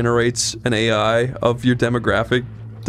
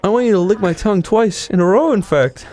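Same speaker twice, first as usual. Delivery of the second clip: mock Peter Parker; 9 semitones higher than usual. Joke line in character voice.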